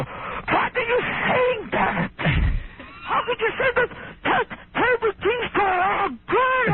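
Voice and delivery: stretched-out falsetto shriek of outrage; mock a scandalized housekeeper, wailing very high; really upset housekeeper. Comedian replays housekeeper's reaction to dirty talk, high outraged wail. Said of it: "Barbara gets really upset".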